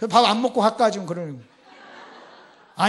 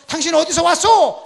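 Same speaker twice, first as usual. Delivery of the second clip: raised, louder acted interrogation; higher, loud questioning in a scout's role; challenging question; 7 semitones higher, 12 dB louder than usual. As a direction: call out a sharp demand to a stranger. Scouts challenge the young Levite.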